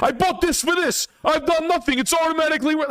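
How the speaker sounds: mock the boastful owner in a squeaky voice; mocking boat owner. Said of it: "That shit ass Tuscan saying".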